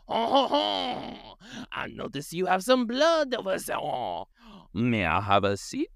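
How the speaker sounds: high-pitched hammed-up French accent; French werewolf. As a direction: play a wailing French werewolf asking politely. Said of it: "there's a French-ass werewolf out there".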